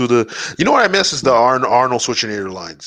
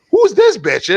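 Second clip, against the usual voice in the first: higher, louder who's this bitch; retold incredulous outburst; 8 semitones higher, 4 dB louder, much slower than usual. Reacting to the mystery girl, high incredulous blurt. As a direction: blurt it out in disbelief. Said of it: "I'm like".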